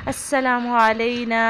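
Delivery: السلام علینا with nun stretched; correct recitation. Shown as a demonstration. Teacher demonstrating السلام علینا. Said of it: "نون کو ہم لمبا کریں گے".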